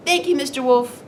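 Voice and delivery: put-on high little-girl voice; high, sing-song politeness; little girl character. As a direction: pipe up as a polite storybook girl. Little girl thanks the wolf.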